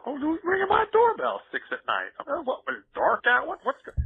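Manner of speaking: high-pitched mock bewilderment; hammed-up, incredulous high voice; baffled reaction. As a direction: act out being startled by the doorbell. Acting out interrupted gamer.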